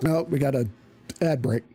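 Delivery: ordinary talk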